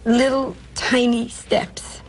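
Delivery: slowed-down, dainty phrasing; slow little steps. Real, not acted. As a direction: drag it out to sound tiny. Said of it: "my career is very slow".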